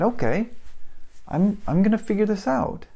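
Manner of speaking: a fresh burst of resolve, voice lifted; quietly determined, voice up high. Retold or imitated. Resolving to try again.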